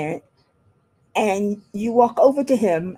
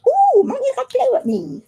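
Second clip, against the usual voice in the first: squeaky high kitty voice, starting with a big louder jump; cat's voice; 4 dB louder than usual. Pretend. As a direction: voice the cat begging Mommy to play, pitched up high. Owner voicing her cat.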